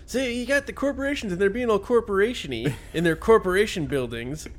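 high, put-on preachy celebrity voice; mocking Tim Robbins. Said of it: "Tim Robbins".

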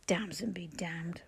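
slow, breathy, hushed scorn; scornful dismissal. A narrator's reading. Father scoffs at the idea his daughter helped, slow hushed scorn. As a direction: brush her name aside with contempt.

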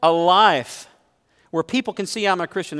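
defensive excuse. Retold or imitated. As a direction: imitate a self-justifying believer. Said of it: "maybe you say".

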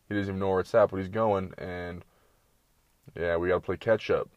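ordinary delivery

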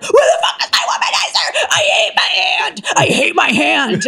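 very high, strained mock scream; hammed-up screaming tantrum. Comedian acting out frantic hunt for her toy, screamed tantrum. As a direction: shriek like a desperate addict, played for laughs. Comic tantrum over missing womanizer.

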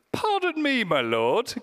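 high, fussy very British pardon me; posh British reading. Pretend. Preacher mocks the Bible's polite Gideon in a very British voice. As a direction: say it like an overly polite Englishman. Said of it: "the most British Bible translation".

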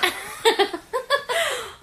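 bright burst of laughter; high giggle. Real, not acted. Laughing at herself.

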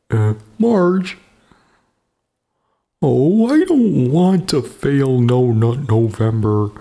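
slow high cartoon dad voice; Homer Simpson. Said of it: "did I just sound like fucking Homer Simpson".

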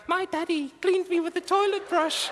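a hint of a wounded grown-up child; mock grown daughter. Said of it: "twenty years later in therapy".

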